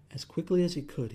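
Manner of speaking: usual speaking voice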